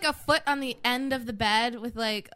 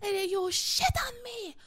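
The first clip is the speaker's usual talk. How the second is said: mock high scream; 12 semitones higher than usual. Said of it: "She's screaming at him".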